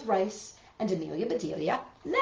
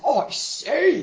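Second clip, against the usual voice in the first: louder, raised call; 7 semitones higher, 9 dB louder than usual. Acted. Mister Rogers calling from the bathroom, loud call. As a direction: call out from the other room. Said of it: "he called".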